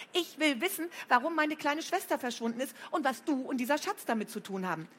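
high insistent demand; insistent anger. A narrator's reading. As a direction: press hard for answers. Flo angry about sister.

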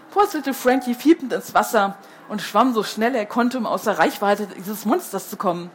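ordinary delivery, nothing marked

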